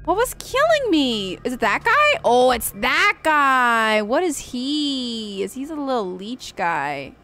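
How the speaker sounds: ordinary talk, nothing marked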